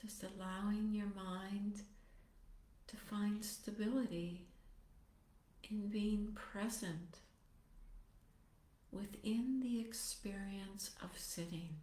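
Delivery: soft, unhurried meditation voice with long pauses; guided meditation. Acted. Teacher settling meditators into posture and compassionate motivation, guided meditation. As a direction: ease them gently into stillness, phrase by phrase.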